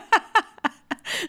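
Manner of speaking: loud high laughter; loud high laugh at the story. Real, not acted. Laughing hard.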